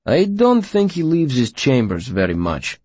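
a lightly voiced student; young man voice. Will's voice, distinct timbre.

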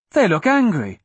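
put-on high, uneasy child's voice; young girl. A narrator's reading. Young girl worried by the men.

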